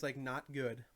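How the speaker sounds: ordinary talk, nothing marked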